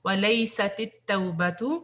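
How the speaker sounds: ordinary talk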